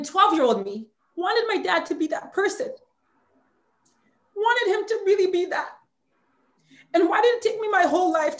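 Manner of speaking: holding back crying while talking about dad; fighting tears. Real, not acted. Guest fighting tears about her absent father, voice climbing high. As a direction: voice rising high as tears push through. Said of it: "I'm gonna try not to cry".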